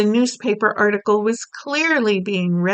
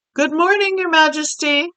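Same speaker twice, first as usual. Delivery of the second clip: maid's voice; 7 semitones higher, 4 dB louder than usual. Acted. Maid greeting the Queen.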